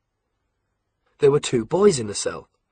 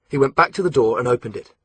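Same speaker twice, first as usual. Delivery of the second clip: plain reading voice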